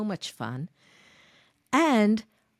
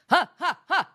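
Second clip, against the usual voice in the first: high loud ha ha ha; bright vocal exercise has; high staccato ha warm-up; 8 semitones higher, 7 dB louder than usual. Shown as a demonstration. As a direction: punch out short high has. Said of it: "You can go like this".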